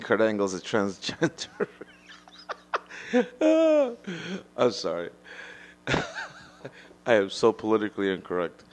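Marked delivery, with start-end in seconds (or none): 2.4-4.5 high burst of real laughter; big laugh. Real, not acted. Laughing at his own joke about Kurt Angle. 5.1-6.4 high squeaky laugh. Real, not acted. Laughs again after apology.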